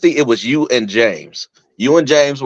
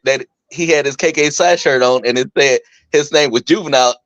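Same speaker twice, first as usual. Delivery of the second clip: usual speaking voice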